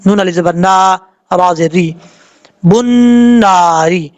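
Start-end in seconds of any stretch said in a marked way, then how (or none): 2.6-4.1 बुन्नारी with the doubled नून held in the nose; nasalised ghunna. Shown as a demonstration. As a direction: hum the नून of बुन्नारी for one alif length. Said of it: "गुन्ना करेंगे एक अलिफ की मिकदार". (बुन्नारी में नून पर एक अलिफ तक गुन्ना)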